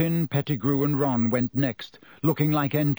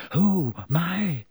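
higher, alarmed gasp; 4 semitones higher than usual. A narrator's reading. Hermione seeing Lupin begin to change, gasping. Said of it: "Hermione gasped".

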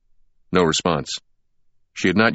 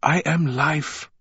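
slow ghostly woman; 9 semitones higher, slower than usual. A narrator's reading. Woman in vision speaks.

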